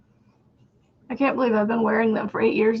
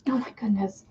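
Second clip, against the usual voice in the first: whispered aside; 7 dB quieter than usual. Real, not acted. Muttered aside.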